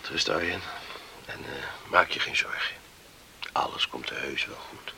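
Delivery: whispered comfort; calm him down in a hush; soft reassuring whisper. Acted. Reassuring in bed at night.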